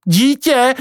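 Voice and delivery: high-pitched, incredulous question; surprised exclamation. Acted. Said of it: "zvolal Pako". (udivené, vysoké zvolání)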